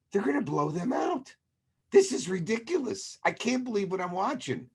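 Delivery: can't believe the blowout; exasperated disbelief. Retold or imitated. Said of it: "I'm, like, going".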